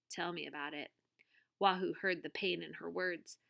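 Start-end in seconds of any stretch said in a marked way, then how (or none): 0.1-0.9 soft, low and bitter; quiet bitter mutter. A narrator's reading. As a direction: mutter it with hidden hurt. Tuna bitter about father.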